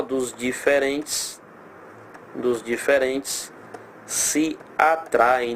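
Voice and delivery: Dos diferentes se atraem drawn out word by word; teacher slowly spelling out the rule; slow dictation. Shown as a demonstration. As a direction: let each word sit before the next. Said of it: "dictating the rule slowly".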